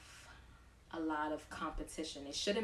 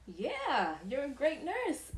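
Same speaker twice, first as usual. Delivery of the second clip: high, louder affirming voice; fond patient; 9 semitones higher, 6 dB louder, slower than usual. Retold or imitated. Patient assuring the new nurse, high and fond. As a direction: say it like a proud patient.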